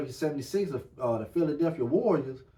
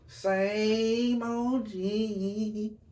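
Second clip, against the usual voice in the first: slow, stretched-out crooning drawl, a bit higher; 8 semitones higher, much slower than usual. Retold or imitated. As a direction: draw every word out like a slow love song. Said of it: "I just told y'all about [name]".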